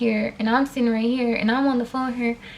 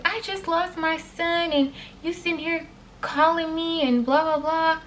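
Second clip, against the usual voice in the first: a hint of the grieving grandma losing it, higher; mocking the upset lady; 6 semitones higher than usual. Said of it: "this lady started screaming at me".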